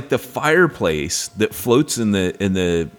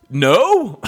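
defensive denial; 10 semitones higher, 5 dB louder than usual. Retold or imitated. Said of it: "And I go".

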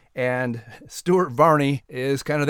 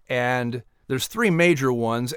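ordinary talk

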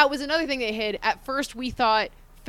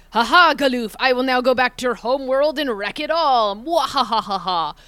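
loud gloating villain with cackle; 3 semitones higher, 5 dB louder than usual. Retold or imitated. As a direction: ham it up like a cartoon villain. Villain X-Death quoted.

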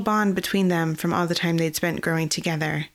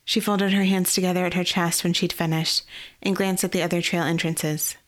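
plain reading voice

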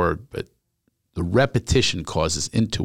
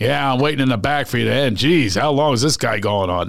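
impatient listener; 2 semitones higher than usual. Retold or imitated. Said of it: "That's what that sounded like to me".

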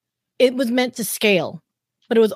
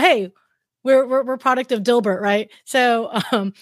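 normal talking voice

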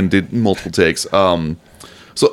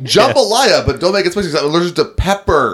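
demanding customer; 10 semitones higher than usual. Pretend. Acting out a restaurant order.

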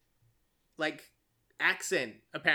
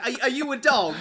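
louder, higher mock gangster question; quoted gangster line; 5 semitones higher, 7 dB louder than usual. Podcaster quoting a gangster's insult, louder and higher. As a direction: bark the question like Bricktop would.

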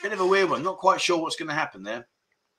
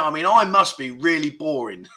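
usual speaking voice

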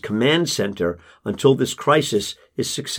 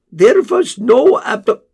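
Casper hedging to the father, higher and level; Casper's voice; 12 semitones higher, 5 dB louder than usual. Acted. Casper cut off mid-word.

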